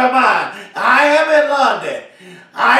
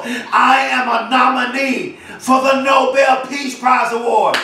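ordinary delivery

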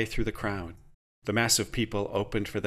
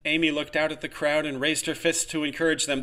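usual speaking voice

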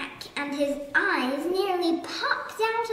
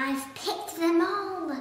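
ordinary delivery, nothing marked

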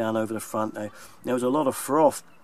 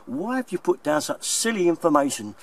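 exasperated mock complaint, pitched up; indignant complaint; 7 semitones higher, 4 dB louder than usual. Plumber quoting a viewer's complaint about his video, mock indignant. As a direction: imitate an affronted viewer, voice raised in pitch.

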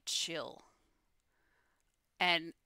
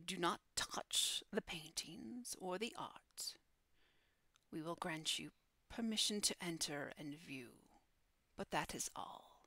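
solemn guardian Infernia; 7 dB quieter than usual. Acted. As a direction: grant entry with a quiet, measured warning. Infernia grants entry with warning.

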